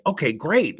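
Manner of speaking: an encouraging, upbeat acknowledgment. Retold or imitated. Manager coaching the young guy, upbeat. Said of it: "you gotta be upbeat".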